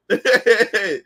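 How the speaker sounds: chuckle. Real, not acted. Laughs at own story.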